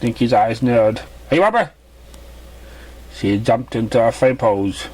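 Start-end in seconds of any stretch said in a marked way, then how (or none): 1.3-1.7 a bright little filly voice, pitched well up; high filly voice. Acted. Curious filly questioning the stranger in a high voice. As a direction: play the curious young filly.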